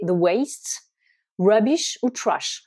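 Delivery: the waste, rubbish, trash said slowly and clearly; slow English vocabulary. Shown as a demonstration. Teacher listing English words for waste slowly. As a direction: model each word slowly for learners. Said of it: "Les déchets, c'est".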